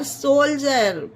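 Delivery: सोल्जर with D as a J sound; correct pronunciation. Shown as a demonstration. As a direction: say सोल्जर ending in "jer". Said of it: "इसमें डी का उच्चारण जा हो रहा है".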